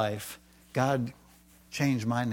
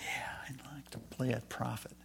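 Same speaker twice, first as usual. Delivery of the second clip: much softer, unvoiced whisper of the quote; whispered reply; 13 dB quieter than usual. New member answering the drama team, in a soft whisper. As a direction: whisper it like an eager secret yes.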